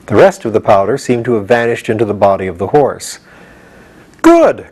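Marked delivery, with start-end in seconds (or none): 4.2-4.7 high, loud pleased call; delighted call. A narrator's reading. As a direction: cheer it out. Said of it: "called Jack".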